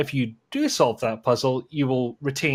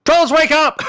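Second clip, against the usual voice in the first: shout; 10 dB louder than usual. Acted. Host yells at sleepy panel.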